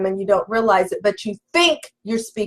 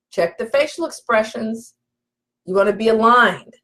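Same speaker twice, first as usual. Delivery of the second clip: normal talking voice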